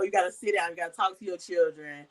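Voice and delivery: ordinary talk, nothing marked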